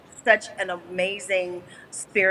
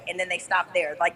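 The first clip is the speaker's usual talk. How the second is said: ordinary talk, nothing marked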